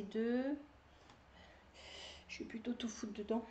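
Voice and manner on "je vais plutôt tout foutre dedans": fast hushed mutter to self; whispered to herself. Real, not acted. Knitter muttering to herself while stuffing hats, whispered. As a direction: mutter it under your breath.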